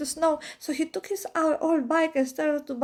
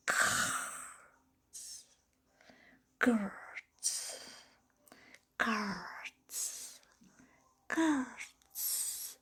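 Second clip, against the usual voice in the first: whispered, drawn-out rasp of a sound; eerie scratching sound; 3 semitones lower, 10 dB quieter, much slower than usual. Retold or imitated. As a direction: breathe the creepy noise slowly. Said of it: "a sound".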